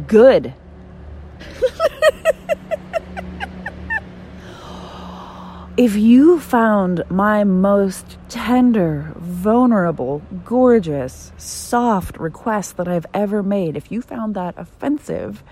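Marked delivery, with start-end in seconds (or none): 0.1-0.5 one loud clipped word, pitched up; curt loud good. Retold or imitated. To unsubscribers, a loud satisfied good. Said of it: "I went". 0.6-5.7 high, airy burst of laughter that keeps going; long high laugh. Real, not acted. After mocking the unsubscribers, a long high laugh. 5.8-13.8 slow, high and louder, sarcastically drawn out; mock tender. To offended subscribers, slow mock-tender sarcasm. Mocking her own soft request.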